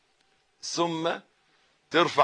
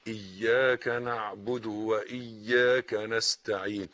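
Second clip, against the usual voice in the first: ordinary talk, nothing marked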